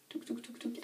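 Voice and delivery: soft voiceless tapping sounds while searching; a breathy touc touc under her breath; whispered touc touc. Real, not acted. Rummaging for the next product.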